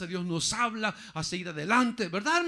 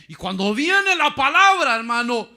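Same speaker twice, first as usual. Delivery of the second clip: high, loud preacher's cry; fervent pulpit call; full-force preaching; 6 semitones higher, 14 dB louder than usual. Acted. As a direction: lift the congregation with a shout. Preacher building to climax.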